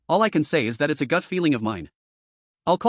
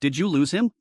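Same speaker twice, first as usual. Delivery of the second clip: usual speaking voice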